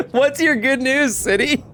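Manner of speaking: slow giggling mock question; laughing. Real, not acted. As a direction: laugh through a teasing reply. Mocking the spammer, laughing.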